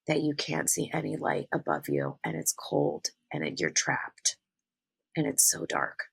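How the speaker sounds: low, soft voice sinking away; sinking into a creaky, subdued hush; hushed, bleak recollection. Retold or imitated. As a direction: let it drop low and quiet, as if trapped in the dark. Describing being trapped in dark well.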